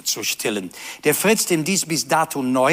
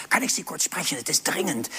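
urgent hushed plea; 5 dB quieter than usual. Acted. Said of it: "dann sagt er zu der Verkäufer".

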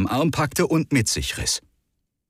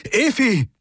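calling out; 13 semitones higher than usual. Acted. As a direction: cry the name into the dead comm. Calling dead comm link.

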